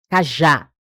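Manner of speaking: cajá, low with a punched já; stress on last syllable. Shown as a demonstration. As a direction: land firmly on the já of cajá. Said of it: "A última sílaba é mais forte".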